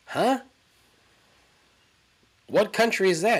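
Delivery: high, puzzled mock surprise; confused Hoff. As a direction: play the Hoff baffled by the news. Hoff's baffled reply voiced.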